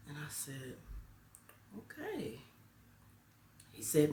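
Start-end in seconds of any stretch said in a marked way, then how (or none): 1.8-2.4 wary okay. Retold or imitated. Teacher warily accepts child's news.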